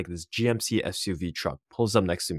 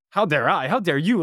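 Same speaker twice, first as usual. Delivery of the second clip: higher, incredulous comeback; indignant retort; 6 semitones higher, 3 dB louder than usual. Retold or imitated. Student retorting to the jacket seller, mock-indignant. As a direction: throw it back at him, pitched up. Student throws the reproach back.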